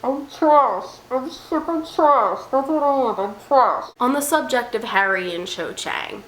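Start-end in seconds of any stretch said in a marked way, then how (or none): 0.0-3.9 high, put-on confession; mock self-loathing. Shipper confesses to being trash, high mock lament. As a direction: wail it in a higher put-on voice.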